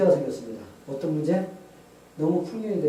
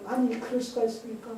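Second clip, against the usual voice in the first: high-pitched, mild mock yell; protesting cry; 9 semitones higher, 3 dB louder than usual. Retelling the ruined scientist questioning God, protesting cry. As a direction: protest to heaven in a raised pitch. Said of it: "막 소리 지르면서".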